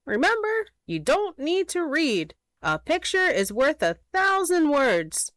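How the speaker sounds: high, squeaky little critter; high-pitched sly hedgehog pitch. Acted. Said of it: "in his squeaky little voice".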